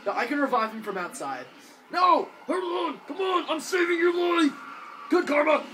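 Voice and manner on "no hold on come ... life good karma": urgent shouting. Real, not acted. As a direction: shout while reviving him. Reviving teammate under attack.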